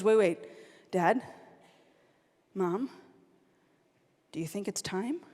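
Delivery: low, soft, halting question with long pauses; cautious hint. Retold or imitated. Sister asking parents about revealing a secret, cautious. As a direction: tread carefully, imitating a hesitant sister.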